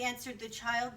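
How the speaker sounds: ordinary delivery, nothing marked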